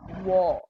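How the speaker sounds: "War" with a dropped jaw and open aw; "War" as a single rounded, open syllable; open-jawed pronunciation. Shown as a demonstration. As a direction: demonstrate "War" slowly for students, mouth wide open. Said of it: "important that you open your jaw".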